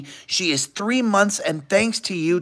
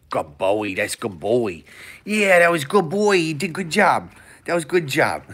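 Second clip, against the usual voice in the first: dog voice; 7 semitones lower, 3 dB quieter than usual. Retold or imitated. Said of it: "[name] is like".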